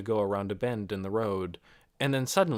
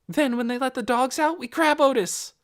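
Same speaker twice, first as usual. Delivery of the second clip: put-on high, loud girl voice; girl planning to grab her dog; eager girl; 6 dB louder than usual. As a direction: plan it breathlessly. Nikki voiced.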